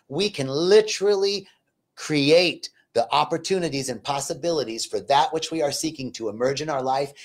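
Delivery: usual speaking voice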